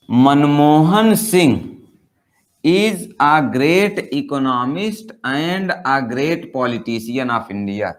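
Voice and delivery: each word spelled out very slowly; slow dictation. Shown as a demonstration. Teacher reads the example to the class, very slow. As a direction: read it out slowly like dictation for students.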